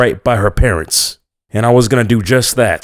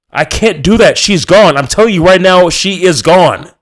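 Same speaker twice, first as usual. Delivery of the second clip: high and loud, last words slowed; desperate; 9 semitones higher, 5 dB louder than usual. A narrator's reading. Narrator insists to the parents the girl is gone, high and loud. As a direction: insist through rising desperation.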